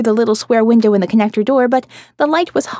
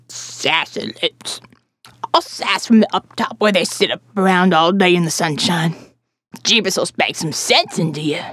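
put-on preachy old woman; 5 semitones lower than usual. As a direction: scold her like a bible-thumping neighbour.

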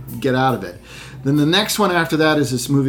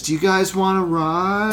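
higher, weird sing-out offer; Nick Cage doing Emo Phillips; 4 semitones higher than usual. Retold or imitated. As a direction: imitate an oddball comedian offering a lift. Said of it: "an impersonation of Emo Phillips".

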